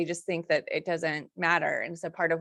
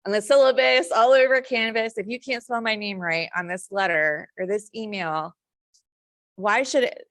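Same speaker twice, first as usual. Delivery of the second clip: emphatic lecture; 3 semitones higher, 6 dB louder than usual. Retold or imitated. Lecturing students on spelling.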